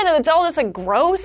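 usual speaking voice

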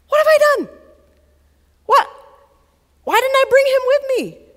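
high, loud cry of second thoughts; sudden panic. Acted. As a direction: blurt it out high, as if horrified. Father panicking on the walk home.

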